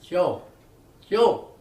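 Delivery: loud calling hollers of a name; father hollering for his son from the far end of the yard, loud. Retold or imitated. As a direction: call the boy's name across the yard. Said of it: "he hollered".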